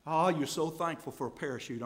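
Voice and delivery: normal talking voice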